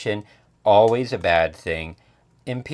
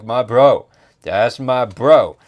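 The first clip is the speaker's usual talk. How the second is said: loud, drawn-out hint of bro talk; lightly voiced bro; 9 dB louder than usual. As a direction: hail a buddy with mild bravado. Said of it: "And he's like".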